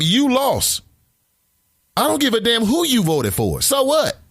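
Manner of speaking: high-pitched exasperated retort; scornful. Acted. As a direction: throw it back at them, voice way up. Scornful retort to Trump voters.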